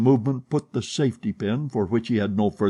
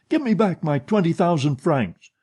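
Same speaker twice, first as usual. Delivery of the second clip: angrier repeated demand; 7 semitones higher, 3 dB louder than usual. Acted. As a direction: Give pushed higher and harder in the repeat. Demanding money from his partner.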